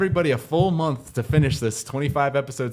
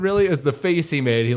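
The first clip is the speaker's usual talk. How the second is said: usual speaking voice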